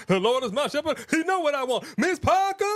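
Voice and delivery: high laughing mock-preach; laughing mock praise. Joking about the outfit, high laughing mock preach.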